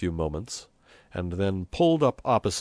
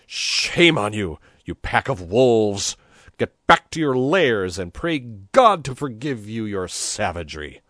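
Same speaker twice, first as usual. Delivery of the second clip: thundering rebuke; 4 semitones higher, 7 dB louder than usual. A narrator's reading. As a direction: shame a whole crowd at full voice. Priest berates the mob.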